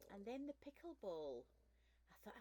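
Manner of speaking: normal talking voice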